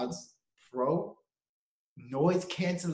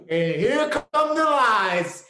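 laughing; 7 semitones higher, 9 dB louder, much slower than usual. Real, not acted. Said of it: "slow laughing aside".

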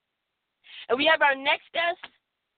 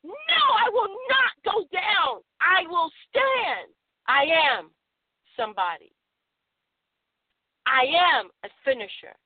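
defiant declaration. Shown as a demonstration. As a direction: plant your feet and declare it. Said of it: "you take a hold of it and you say".

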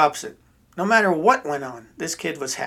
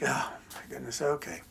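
softer, lower mock resignation; deflated parents; 3 semitones lower, 10 dB quieter than usual. Parents hearing the toys didn't work, quietly deflated. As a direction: say it under the breath, let down. Parents let down by son's answer.

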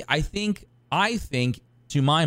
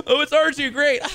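much higher, louder squeal of relief; high mock relief; 4 dB louder than usual.

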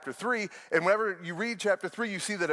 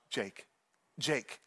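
softer, hushed urgent calling of a name; urgent whisper; 5 semitones lower, 8 dB quieter than usual. Retold or imitated. Frightened wife shaking husband awake in bed, hushed urgency. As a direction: wake him quietly but urgently. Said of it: "she shoots up and she".

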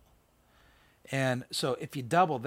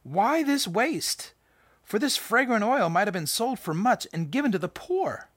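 higher, slow, drawn-out scoffing complaint; mock indignant disciples; 9 semitones higher, 5 dB louder than usual. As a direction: voice the disciples' outrage at the waste. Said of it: "they were indignant".

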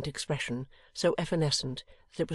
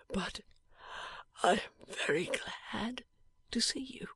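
barely voiced, dying breath; a dying man's faint whisper; 6 semitones higher, 6 dB quieter than usual. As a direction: whisper it weakly from a sickbed.

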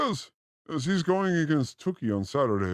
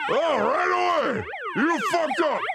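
mock scolding woman; 10 semitones higher than usual. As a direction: yell it like a furious partner. Acts out women scolding.

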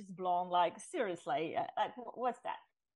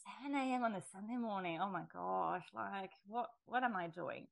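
mild disbelief at herself; 6 dB quieter than usual. Retold or imitated. Dismay after hanging up.

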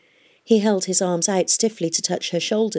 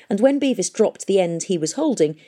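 ordinary delivery, nothing marked

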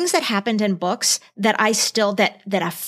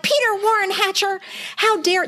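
mock angry parents; 9 semitones higher than usual. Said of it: "they get really mad at him".

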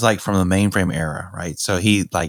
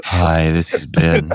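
sultry phone voice; 6 semitones lower, slower than usual. Pretend. Mocking the sultry phone greeting.

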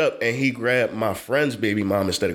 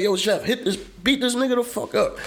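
high, excited retold egging on with a laugh; hyped urging; 10 semitones higher than usual. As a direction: egg him on mid-fight. Friend urging him in fight.

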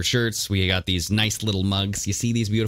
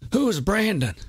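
put-on higher, puzzled old politician voice; Creepy Joe voice; 10 semitones higher than usual. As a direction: play a confused elderly politician, pitched up. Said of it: "like frickin' Creepy Joe".

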